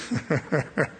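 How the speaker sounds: soft, low chuckle. Real, not acted. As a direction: laugh quietly to yourself. Chuckling at the prisoner's reply.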